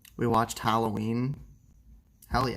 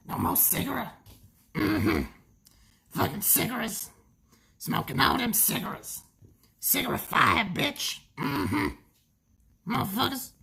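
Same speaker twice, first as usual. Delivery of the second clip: much higher, mock nagging voice; high squeaky scolding voice; 12 semitones higher than usual. Streamer mocks his own smoking, high squeaky scold.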